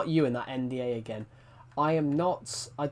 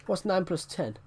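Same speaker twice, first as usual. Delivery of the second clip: ordinary talk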